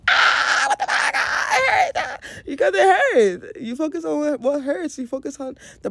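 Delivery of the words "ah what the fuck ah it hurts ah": high, loud yelp of pain; hammed-up shouted ouch, much higher and louder; mock pain yell. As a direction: cry out like you just stubbed your toe. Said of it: "We all go".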